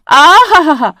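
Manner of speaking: hammed-up indignant laugh; mock laugh. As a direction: scoff out loud in disbelief. Vimla's mock outraged laugh.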